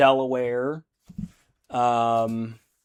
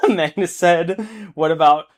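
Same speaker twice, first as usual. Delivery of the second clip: laughing; 3 semitones higher, 6 dB louder than usual. Real, not acted. Reading a silly comment aloud.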